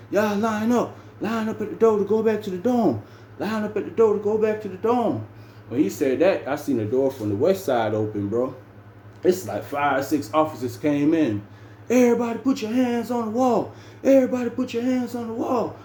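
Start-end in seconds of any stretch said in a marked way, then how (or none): 0.1-5.2 mock officer announcement, pitched way up; officer giving orders. 11.9-15.7 high-pitched imitation of a police command; mock raid command, pitched way up; officers shouting orders. Raiding officers' command acted out.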